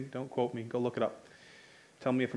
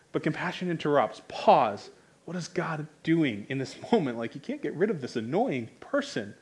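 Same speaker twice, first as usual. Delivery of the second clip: normal talking voice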